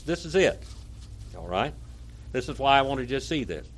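ordinary talk, nothing marked

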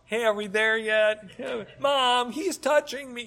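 put-on high whiny kid voice; whiny tattling little sibling. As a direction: whine like a bored child in the car. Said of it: "You know how that goes".

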